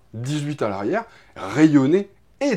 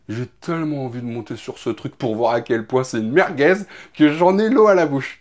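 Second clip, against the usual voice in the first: ordinary talk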